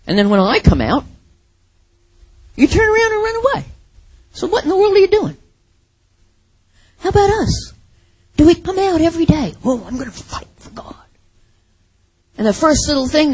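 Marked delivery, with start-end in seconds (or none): 2.5-9.5 high, fast taunting voice; mocking giant. Preacher mocks the cowards as Goliath, high and fast. 9.6-11.1 whispered mock-pious vow; mock bravado. Preacher mimics churchgoers' empty promise in a whisper.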